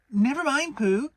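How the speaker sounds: high, loud impatient Piglet; hurrying past the silly point; Piglet, high and urgent. A narrator's reading. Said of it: "said Piglet impatiently".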